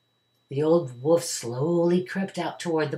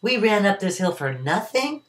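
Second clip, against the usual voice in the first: plain reading voice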